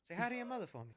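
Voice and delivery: half-hearted impersonation of an actor; Mark Wahlberg. Retold or imitated. Reviewer giving his best go at the star's regular voice, rough impression. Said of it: "Best Mark Wahlberg impression".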